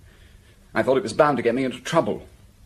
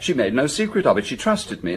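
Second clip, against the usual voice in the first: normal talking voice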